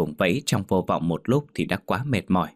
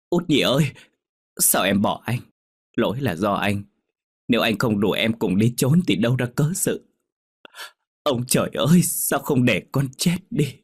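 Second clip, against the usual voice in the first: anguished screaming; 4 semitones higher than usual. A narrator's reading. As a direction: scream into the rain in grief. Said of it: "gào thét". (Đạt gào thét trong mưa, cao và to vì đau đớn)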